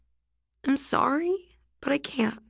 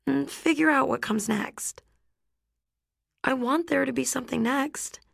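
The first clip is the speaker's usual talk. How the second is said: put-on higher girl's voice, sadly letting him down; higher voice of a teen girl saying no with care; Rhiannon, gentle but firm; 6 semitones higher than usual. A narrator's reading. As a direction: break it to him kindly but decide.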